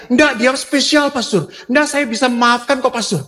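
high-pitched imitation of a pleading woman; acted pleading. Young woman defending her boyfriend to the pastor, acted pleading. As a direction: protest and plead, pitched well up.

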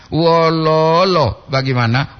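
"Wololo" with the middle lo held long; "lo" panjang. Shown as a demonstration. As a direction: draw out the second syllable of "Wololo". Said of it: "tambah lagi O di lo itu". ("Wololo" ditarik di "lo" tengah)

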